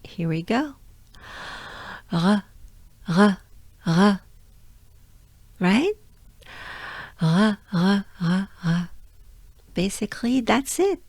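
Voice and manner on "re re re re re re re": "re" as an exhaled French R; tongue down, breathe out each "re"; breath-like French R. Shown as a demonstration. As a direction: separate airy "re" bursts after a good breath. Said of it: "exhale and keep our tongue down".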